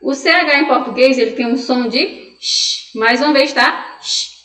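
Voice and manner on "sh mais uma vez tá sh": SH as a long hushing hiss. Shown as a demonstration. Teacher showing the Portuguese CH sound, hissed sh. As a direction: hold the SH, pure hissing air.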